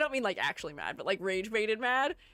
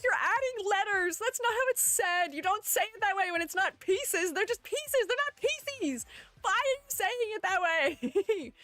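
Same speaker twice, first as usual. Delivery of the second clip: high, mock-exasperated friend; imitating Jubby; 9 semitones higher than usual. Streamer imitating her annoyed friend Jubby, high and mock-exasperated. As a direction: play the friend fed up with her pronunciation, pitched up high. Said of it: "Jubby's like".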